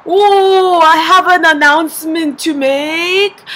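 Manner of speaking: loud, high, drawn-out announcement; booming mock-grand dad impression; imitated proud dad. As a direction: proclaim it slowly like a dad at dinner. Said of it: "my dad starts going".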